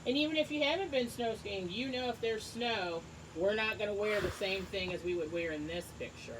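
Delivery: normal talking voice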